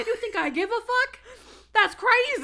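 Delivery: high laughing disbelief; mock outrage. Real, not acted. Teasing friend about caring for Olympics, high laughing disbelief. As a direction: squeal it like it's absurd.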